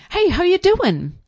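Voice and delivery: shouted high sales guy greeting; loud hyper salesman. Retold or imitated. Sales guy startling a customer, loud high greeting. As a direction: jump out at her with a loud high hello.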